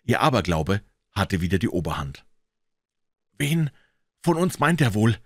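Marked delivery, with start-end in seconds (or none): 3.4-5.2 high, mildly groaning question; groaned. A narrator's reading. Huck hears the dog howl, groaning to Tom. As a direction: groan it out in dread. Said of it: "ächzte Huckleberry".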